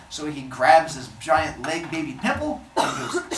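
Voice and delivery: ordinary delivery, nothing marked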